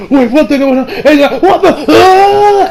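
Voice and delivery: rising high into a scream; panicked confusion. Retold or imitated. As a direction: confusion building to a mock scream. Acting character's shock, ends screaming.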